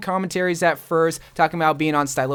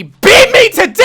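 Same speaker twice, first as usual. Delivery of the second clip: sudden joking yell at the top of the voice; mock shout; 13 dB louder than usual. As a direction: shout the punchline as a fake-out. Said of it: "I'm kidding".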